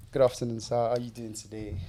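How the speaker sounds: put-on quiet, formal greeting to a girlfriend's father; nervous suitor meeting the dad; polite suitor. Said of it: "You gotta role play".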